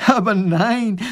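high, protesting professor; indignant correction. Acted. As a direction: dismiss the silly idea excitedly. Professor corrects the silly guesses.

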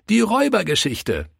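put-on high-pitched child's answer; child calling out. A narrator's reading. Children asking the pastor for their favourite story, high child voice. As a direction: pipe up like an eager child.

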